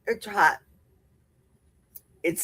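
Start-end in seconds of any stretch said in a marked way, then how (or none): none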